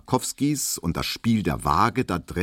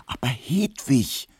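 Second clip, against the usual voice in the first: higher, slow, urgent appeal; insistent pleading; 6 semitones higher than usual. A narrator's reading. Barber presses the maid close beside her, pleading insistently. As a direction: press the plea, voice raised in pitch. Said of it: "flüsterte Emil Kubinke eindringlich".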